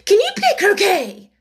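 high, very loud demand; shouted question. A narrator's reading. The Queen asks Alice about croquet, shouting. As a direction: bellow it imperiously.

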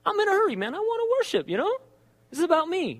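high, hurried mock complaint; playing his impatient self, high and pushed; mock selfish whine. Pastor mocking his own hurry to worship, high whiny voice.

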